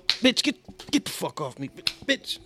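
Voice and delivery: acting out annoyed swatting; short bursts of mock annoyance; mock irritation. Person swatting at a mosquito, mock irritation.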